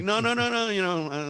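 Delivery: high-pitched, run-together no-nos; retold hasty refusal. Customer turning down soda, hastily waving it off.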